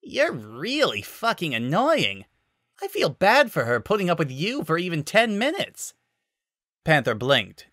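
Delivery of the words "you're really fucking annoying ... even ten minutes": smirk through the barb; conceited smirk. A narrator's reading. Miyu insulting Panther, smug and higher. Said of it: "conceited smirk".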